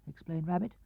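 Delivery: ordinary delivery, nothing marked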